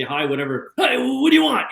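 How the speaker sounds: mock irritated phone answer; rude brush-off. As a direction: snap back at the caller as if bothered. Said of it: "you know, I say".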